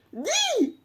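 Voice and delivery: squeaky high pet voice; pet's voice. Pretend. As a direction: give the pet a tiny squeaky voice. Voicing her pet's side of a quarrel.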